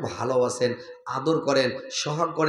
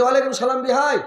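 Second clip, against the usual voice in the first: hearty reply; 8 semitones higher than usual. Retold or imitated. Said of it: "কইছে".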